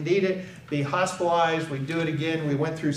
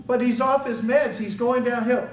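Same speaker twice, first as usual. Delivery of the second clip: higher-pitched retold plea; mildly imploring, pitched up; pleading; 6 semitones higher than usual. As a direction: plead your case to a dispatcher. Pleading with crisis line dispatcher.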